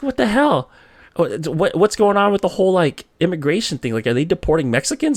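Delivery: high-pitched, loud, flustered demand; alarmed questioning. Retold or imitated. Shaken son phones mom about immigration, mock alarm. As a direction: act out a frantic call for answers.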